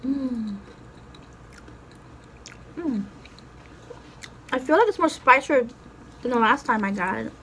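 chewing while talking; talking between bites of food; mouth full. Real, not acted. Seen 3: Holds empanada, chewing with puffed cheeks; bites happen only before/after.

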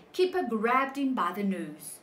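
ordinary delivery

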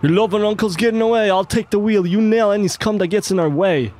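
high, quick character voice; urgent game partner. Acted. Partner takes the wheel as the uncle escapes, high urgent voice.

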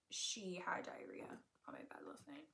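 hushed confession, voice gone breathy; embarrassed whisper about the dog. Real, not acted. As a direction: whisper it like a secret.

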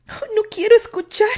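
high, loud fearful plea; dreading. A narrator's reading. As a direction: Annie covers her ears, high and fearful. Annie se tapó los oídos.

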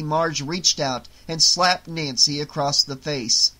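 usual speaking voice

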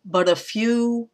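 "but a" light, FEW high and drawn out; two unstressed syllables, then hold "few", slowly; anapest. Shown as a demonstration. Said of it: "starts with another anapest".

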